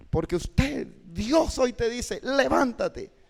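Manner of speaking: high, slow exhortation; exhortative preaching. Shown as a demonstration. Teacher demonstrates an exhortative message to students, urgent exhortation. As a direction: exhort them, voice lifted and drawn out. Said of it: "cuando estamos predicando mensajes exhortativos".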